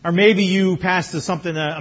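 normal talking voice

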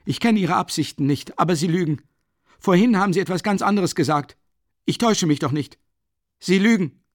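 heated accusation. Acted. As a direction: keep hammering that he is lying. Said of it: "rief er aus".

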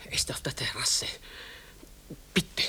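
hushed urgent whisper. Acted. Mother warning her son the guest is outside, urgent whisper. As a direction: whisper it so he outside can't hear.

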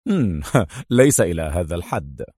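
low chuckle then an easy reply; laughing. A narrator's reading. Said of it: "ضحك قائلًا".

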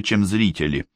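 ordinary delivery, nothing marked

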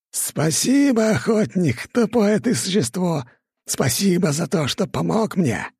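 high, gloating villain voice; mock him, high and triumphant; mad villain. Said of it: "продолжала безумно хохотать". (безумный торжествующий голос злодея)

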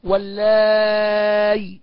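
واللاي with a very long aa closing on a still y, "wallaaay"; hamza turned into ya. Shown as a demonstration. Teacher showing the substitution reading, واللاي ending in a long-held y. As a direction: draw out واللاي slowly and end on y, no glottal stop. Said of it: "يبدل الهمزة ياء ساكنة".

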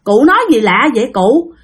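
put-on father pushing back at the old man, baffled and indignant; indignant retort. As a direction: question the stranger, voice climbing at the end. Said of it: "Thì ba mới nói rằng là".

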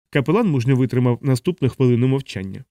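plain reading voice

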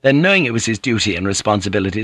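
ordinary delivery, nothing marked

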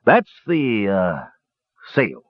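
halting, sheepish answer; embarrassed mumble. A narrator's reading. Dwarf captain explaining the flying sail, awkward mumble. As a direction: hesitate before owning up. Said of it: "Dugan mumbled".

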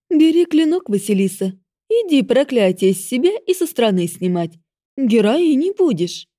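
loud, high commanding goddess. Acted. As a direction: order her with cruel glee.

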